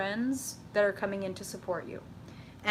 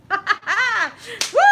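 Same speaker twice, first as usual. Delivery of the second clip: high, loud laughing whoop; a real burst of glee; excited whoop; 11 semitones higher, 10 dB louder than usual. Real, not acted. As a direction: let out a big happy woo. Cheering at the cards.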